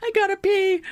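high-pitched frantic whine; frantic movie character. Acted. Podcast guest quoting a diner robber, frantic high voice.

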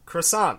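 "Croissant" as kruh-SAHN with plain English r; French accent dropped. Shown as a demonstration. Correcting co-host on the word, accent-free pronunciation. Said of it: "you just drop the French accent".

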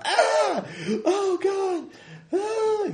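very high, thin cries from far off; faint distant screams. Acted. Victim heard crying out in the distance, thin high screams. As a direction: scream as if barely heard, echoing. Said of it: "it's just a minor, you can barely hear it".

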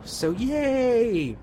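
high, bright little cheer; a quick celebratory yay. Real, not acted. Host cheering the return of a lore segment, high cheer.

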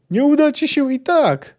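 high defiant shout; childlike shout. Acted. Ciri shouts after departing Geralt, high defiant shout. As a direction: shout it at him like a stubborn little girl. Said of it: "krzyknęła".

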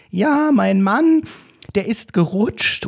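put-on high, slow old-lady voice; hammed-up granny excuse; old woman. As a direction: drag it out like a flustered granny.

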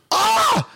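short high-pitched mock scream; imitated scream. Dad retelling the panic in the car, mock scream. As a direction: yelp out a sudden "Ah". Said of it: "so they're screaming like".

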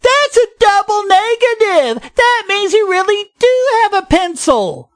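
high-pitched, hammed-up pedant's outcry; mock scream. Podcaster mimicking doctrinaires objecting to double negatives, mock scream. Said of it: "doctrinaires like Mason would scream".

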